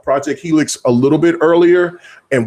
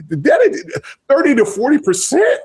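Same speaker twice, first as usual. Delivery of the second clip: incredulous; 11 semitones higher than usual. Real, not acted. Host scoffing at the stupid profit target, incredulous. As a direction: balk at the figure in a raised pitch. Scoffing at the forty percent figure.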